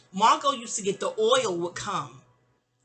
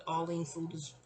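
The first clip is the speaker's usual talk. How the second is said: usual speaking voice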